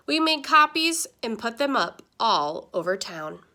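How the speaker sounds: ordinary delivery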